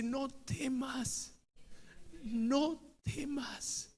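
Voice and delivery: soft, slow and hushed; calm a frightened child; a gentle whisper-soft no temas; tender reassurance. Acted. Tender voice of God quoted.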